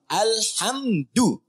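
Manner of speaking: Alhamdu drawn out very slowly, final u held; drawn-out dhammah. Shown as a demonstration. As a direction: stretch Alhamdu, holding the u. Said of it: "Pakai dhammah". (Alhamdu dipanjangkan pelan, u di akhir ditahan)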